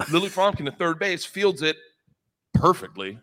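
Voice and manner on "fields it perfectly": usual speaking voice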